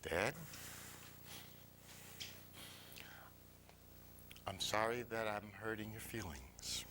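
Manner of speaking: low, halting acted-out apology; reluctant apology. Boy to his father at supper, reluctant low apology. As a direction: force out the apology after a long pause.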